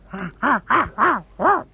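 loud high villain's cackle; villain laughing at his own plight; evil cackle. Acted. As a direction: cackle like a mad scientist.

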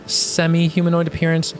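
ordinary delivery

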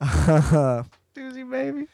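very slow, loud, stretched out; drawn-out mock echo. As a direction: savour each word, playfully drawn out. Echoing phrase, drawn out loudly.

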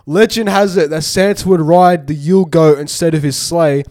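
fact reading. Acted. Reads a fact.

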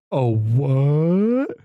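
a light yokel voice, loud, with a rising startled "what"; imitating a yokel. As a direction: play the dumb local, caught off guard. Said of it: "God, I love the yokels".

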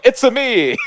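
very high, loud cartoon Italian accent; voice coach doing Mario. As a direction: squeal it like an exaggerated Mario catchphrase. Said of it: "the voice coach is there going".

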